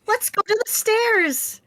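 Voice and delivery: high, excited call to move; eager urging. Acted. Eager creature urges friend toward the stairs, high and excited. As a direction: pipe up high and keen.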